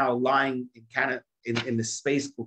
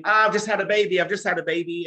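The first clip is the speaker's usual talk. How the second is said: mock scream; 7 semitones higher, 4 dB louder than usual. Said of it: "She started screaming".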